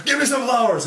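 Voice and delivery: high, loud order to a vendor; acted frantic shout; urgent shouted demand. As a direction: yell it across at the flower seller. Acts urgent demand to seller.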